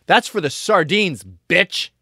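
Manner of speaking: loud, slow taunt; revenge line. Pretend. Getting out of the car to taunt him, loud. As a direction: spit it out as payback. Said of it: "get out of the car and go".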